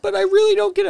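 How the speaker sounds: very high, loud imitation of a baffled kid; mock-distressed protest. Young coworker insists to friend he's clueless, loud high mock distress. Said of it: "when you say it like that".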